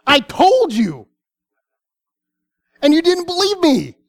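high, exasperated rebuke, pitched well up; exasperated Jesus. Shown as a demonstration. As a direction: throw it back at them, fed up and stung. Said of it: "I probably know that he didn't say it like this".

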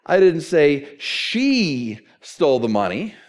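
slow, with "she" stretched out and set apart; stress on SHE. Shown as a demonstration. Preacher showing how emphasis changes meaning, stress on she. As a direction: draw out "she" as if someone else did it. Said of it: "if I change the emphasis".

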